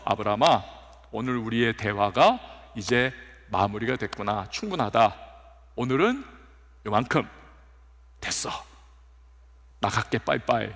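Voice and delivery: God gently wrapping up the talk with Abraham; tender, unhurried goodbye from God to Abraham; gentle God voice. Pretend.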